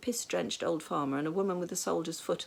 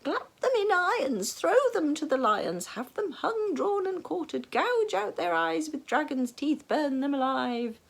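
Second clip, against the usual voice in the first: high, loud ranting list; 11 semitones higher, 7 dB louder than usual. A narrator's reading. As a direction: rant on louder with each punishment. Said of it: "their ranting royal master".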